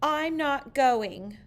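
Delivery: loud slow pouting no; stubborn refusal. A narrator's reading. Sarah refusing her father, pouting and loud. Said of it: "said Sarah".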